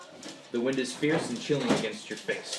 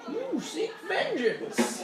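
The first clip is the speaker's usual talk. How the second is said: high, slow mystic proclamation; fortune teller voice; much slower than usual. Acted. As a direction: cry it like an eerie seer. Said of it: "you can hear the fortune teller's voice in your head".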